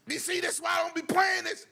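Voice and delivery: very high-pitched whiny yelling; hammed-up falsetto tantrum; mock freak-out. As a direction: imitate a gamer losing it. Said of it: "He's going crazy".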